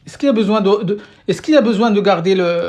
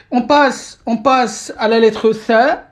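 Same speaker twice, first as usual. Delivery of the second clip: slow, louder naming of the letter Tha; slow announcement; 3 semitones higher, 4 dB louder, slower than usual. Shown as a demonstration. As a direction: spell out Tha slowly for the class. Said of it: "announces new letter". (annonce lente de la lettre Tha)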